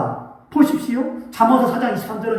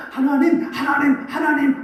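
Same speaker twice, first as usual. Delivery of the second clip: pleading prayer; 6 semitones higher than usual. Acted. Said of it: "하고 하나님만 바라보고".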